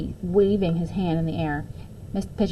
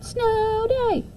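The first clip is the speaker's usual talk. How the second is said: high, gleeful cheer; children cheering for a snow day; excited calling out. A narrator's reading. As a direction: sing it out like a hopeful kid. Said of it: "the children all called out".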